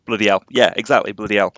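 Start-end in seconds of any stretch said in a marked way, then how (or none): 0.1-0.4 Bloody hell with the H of hell dropped, said as ell. Shown as a demonstration. Streamer to chat, showing the Yorkshire dropped H on hell. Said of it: "we just dropped the H". 1.1-1.5 Bloody hell with the H of hell dropped, said as ell. Shown as a demonstration. Streamer to chat, showing the Yorkshire dropped H on hell. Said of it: "we just dropped the H".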